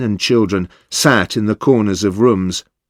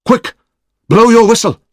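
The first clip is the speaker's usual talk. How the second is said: high, loud urgent order; 12 semitones higher, 5 dB louder than usual. A narrator's reading. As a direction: hurry them with it. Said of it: "said one of the Ronin".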